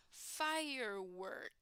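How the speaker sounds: firework drawn out slowly and softly. Shown as a demonstration. Teacher modelling firework again for the class. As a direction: let firework fade out, very slow.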